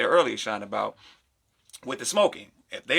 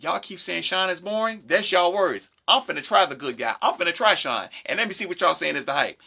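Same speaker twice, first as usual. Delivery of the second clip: put-on girl's voice, pitched up; 5 semitones higher than usual. Girl voiced deciding on Sean.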